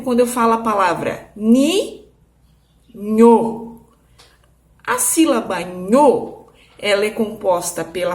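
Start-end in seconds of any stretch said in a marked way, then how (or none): 0.0-3.8 ni, then a pause, then nho louder; teacher splitting a word into syllables; syllables split apart. Shown as a demonstration. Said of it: "por exemplo".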